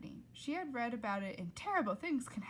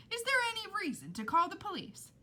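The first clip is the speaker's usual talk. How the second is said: cheery father; 11 semitones higher, 4 dB louder, faster than usual. Acted. Father's voice continues.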